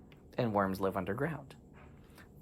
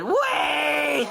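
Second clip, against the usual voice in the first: high shouted plea; desperate wait; 7 dB louder than usual. Acted. Worm begging the mouth to stop, high shout. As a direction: yell it to stop the bite.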